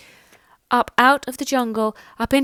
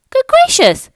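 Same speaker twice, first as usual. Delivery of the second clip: high, loud startled gasp; gasped surprise; 8 dB louder than usual. Acted. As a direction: leap up in shock. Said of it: "gasped Maisie".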